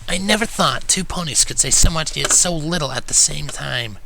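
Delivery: slow smirking tomboy mutter; Dash muttering. A narrator's reading. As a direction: tease two friends with a slow smirk. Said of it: "Dash muttered".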